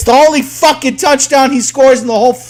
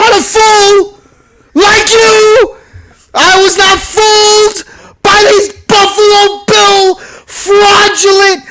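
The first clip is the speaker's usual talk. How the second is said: shouting, crying-laughing rant; wild unhinged ranting; 4 semitones higher than usual. Real, not acted. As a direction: yell it like a vindicated madman.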